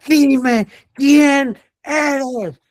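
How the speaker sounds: very high, loud, slow drawn-out shout; shouted demand. Shown as a demonstration. Preacher demonstrating a shouted exorcism, high and loud. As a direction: yell it stretched out at the demon. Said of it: "mi tono de voz es irrelevante".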